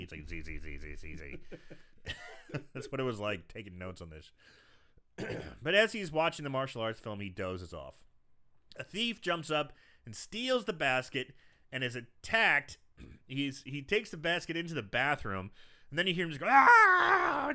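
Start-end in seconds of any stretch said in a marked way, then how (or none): 0.3-1.4 low, dozing drone of Z's; sleepy Z drone. Retold or imitated. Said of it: "your finger falls on the Z". 16.5-17.5 high, loud pained scream; thief screaming. Retold or imitated. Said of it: "just screaming and yelling".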